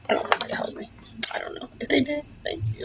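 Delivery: whispering. Real, not acted. Laughing aside about the foundation.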